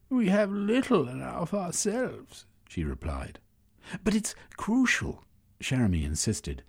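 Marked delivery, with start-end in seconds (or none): 0.1-2.5 high, reluctant neighbour voice; grudging high woman's voice; woman character voice. Acted. Semiotica character voice. 4.0-5.2 urgent, anxious whisper; anxious plea. Acted. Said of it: "allowing his anxiety to show through".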